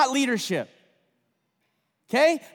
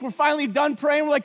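usual speaking voice

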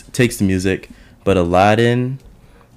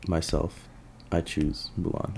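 soft and low; 11 dB quieter than usual. Real, not acted. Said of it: "I don't know why I said it like that".

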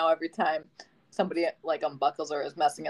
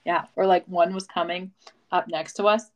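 ordinary delivery

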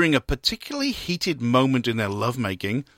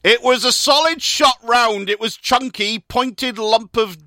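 slow, raised-pitch Gloucestershire spokesperson; Gloucestershire accent; 5 semitones higher, 3 dB louder, slower than usual. Acted. Spokesperson explaining the harmless shell, Gloucestershire accent. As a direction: keep up the put-on Gloucestershire accent. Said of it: "That's a great Gloucestershire accent".

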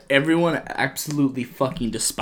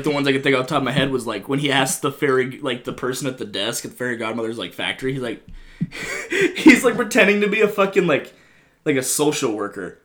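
ordinary delivery

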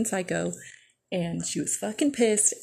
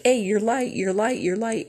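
calling out; 3 semitones higher, 5 dB louder than usual. Retold or imitated. Calling out to the driver.

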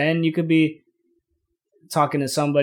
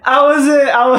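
laughing; 12 semitones higher, 9 dB louder, slower than usual. Real, not acted. Laughing denial of drinking.

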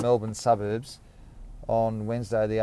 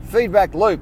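excited title idea; 8 semitones higher, 8 dB louder than usual. Real, not acted. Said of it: "Maybe that's what this podcast will be called".

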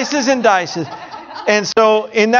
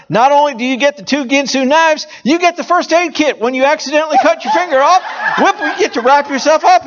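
excited; 8 semitones higher than usual. Retold or imitated. Mimics pitch adding extras.

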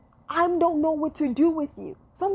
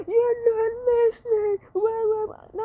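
mock crying woman; 8 semitones higher than usual. Mocking a woman crying at a man.

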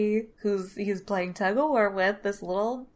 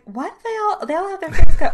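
rising puzzled question, then louder; 8 semitones higher, 12 dB louder, faster than usual. Retold or imitated. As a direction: wonder aloud, baffled. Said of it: "oh, right".

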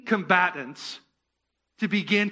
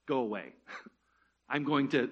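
usual speaking voice

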